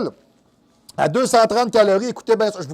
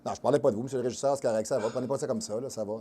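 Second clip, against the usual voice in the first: aparté à voix basse et grave, pour le régisseur seulement; s'excuser tout bas auprès du régisseur; aparté discret; 8 semitones lower, 13 dB quieter, much faster than usual. Real, not acted. (le régisseur se vexe, il s'excuse à voix basse)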